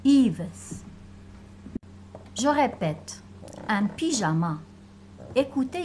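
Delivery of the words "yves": normal talking voice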